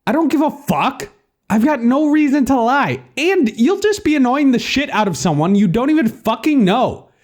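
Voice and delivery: high-pitched sweary tirade; exasperated rant. Acted. Narrator argues with the doubting caller, exasperated. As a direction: rail at him in disbelief.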